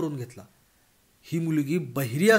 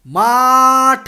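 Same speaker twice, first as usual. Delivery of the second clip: very loud, long-drawn, higher bellow; 7 semitones higher, 13 dB louder, much slower than usual. Acted. Bhagwan bellowing at the deaf girl, very loud drawn-out shout. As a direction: belt the single word out. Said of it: "खच्चून ओरडून भगवानाने सांगितले".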